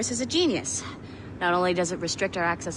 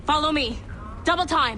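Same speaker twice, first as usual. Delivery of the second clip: louder, higher order to hurry along; urgent command; 5 semitones higher, 4 dB louder than usual. Acted. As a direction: call out to get them moving. Urging someone along during alarm.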